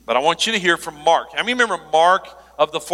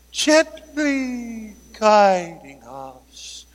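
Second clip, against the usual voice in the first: mock gentle; 5 semitones higher, much slower than usual. Mock-gentle slow delivery.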